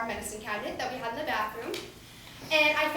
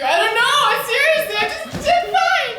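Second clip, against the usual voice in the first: high, loud guilty protest; panicked kid denial; 12 semitones higher, 11 dB louder than usual. Retold or imitated. Child insisting to mom that all is fine, high and loud. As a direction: squeal the denial like a caught child.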